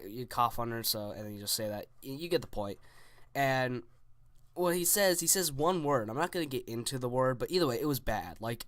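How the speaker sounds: usual speaking voice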